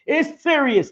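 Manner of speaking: ordinary talk, nothing marked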